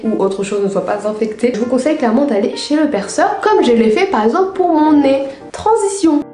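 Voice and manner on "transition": announcer transition. Acted. Announces next section.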